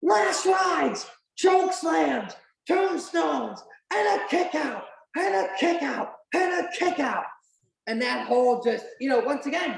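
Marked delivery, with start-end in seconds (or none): 0.0-7.2 high-pitched imitated ringside calls; Jim Ross call. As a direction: punch out each phrase like an excited announcer. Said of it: "Jim Ross hits the, you know, iconic".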